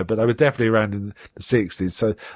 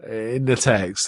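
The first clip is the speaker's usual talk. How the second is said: ordinary talk, nothing marked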